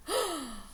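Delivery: sharp high gasp of alarm; a quick theatrical gasp; mock gasp. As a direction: gasp as if shocked by the witch. Reacts to Miss Swamp arriving.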